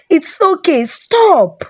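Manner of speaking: high, urgent shout to break up a fight; high shout, stop it now. A narrator's reading. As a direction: shout them apart. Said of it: "she shouted".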